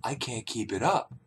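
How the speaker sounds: very soft, low admission; hushed confession. Retold or imitated. Narrator confessing his failure in bed, hushed. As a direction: drop to a tiny, embarrassed hush.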